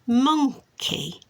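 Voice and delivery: monkey in two beats, mon high, key falling; syllable by syllable. Shown as a demonstration. Repeating the card for a child, syllable by syllable. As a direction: split monkey, pitch up on mon then drop. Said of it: "say the word for the child".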